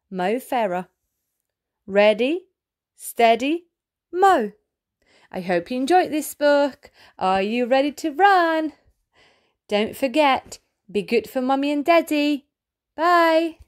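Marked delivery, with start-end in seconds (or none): none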